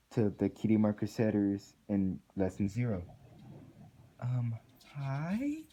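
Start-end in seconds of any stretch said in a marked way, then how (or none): 4.2-5.6 uneasy Twilight. A narrator's reading.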